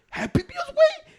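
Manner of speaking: high, squeaky mock disbelief; mock squeak. Friend teasing about Happy Meals, high squeaky mock disbelief.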